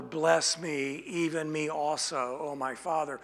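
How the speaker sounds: ordinary talk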